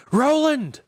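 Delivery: very high, loud scream of a name; desperate shriek. A narrator's reading. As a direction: shriek for rescue. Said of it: "he shrieked".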